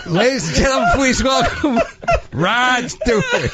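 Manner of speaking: high, laughing announcer voice; laughing retake. Retold or imitated. Host retrying the intro while laughing. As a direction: redo the intro while cracking up. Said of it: "Let me say it again".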